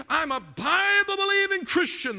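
high, loud mock congregant's boast; imitating a loud, stretched declaration of faith; imitated revival shout. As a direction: shout it like a fervent churchgoer being quoted. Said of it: "how many times have we heard this".

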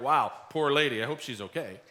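casual, low-key sympathy in passing; detached pity. Acted. Imagined passerby pitying a grieving woman, offhand pity.

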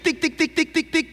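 high, quick tic tic tic like a telegraph key; light clicking tic sounds; imitated telegraph ticking. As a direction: tap out Morse code with the voice. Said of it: "lo que decía ese tic".

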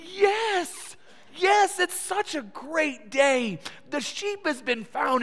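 high, slow, laughing yes; beaming with joy over the found sheep; delighted laughing crowd. Retold or imitated. Crowd rejoicing over the found sheep, laughing. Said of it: "And they'd say".

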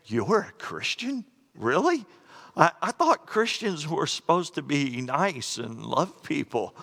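a stranger shocked to hear it; mock disbelief. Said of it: "horrible reaction".

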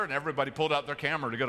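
normal talking voice

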